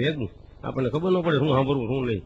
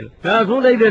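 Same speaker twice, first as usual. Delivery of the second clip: put-on angry child protest; 7 semitones higher, 9 dB louder, slower than usual. Boy protests to father.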